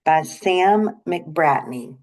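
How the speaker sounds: usual speaking voice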